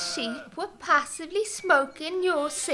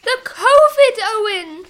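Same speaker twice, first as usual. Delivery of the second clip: "covid" shouted with a hard K; exasperated correction; 8 dB louder than usual. Acted. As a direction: correct him loudly, hitting "covid". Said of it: "what is the Dovis?".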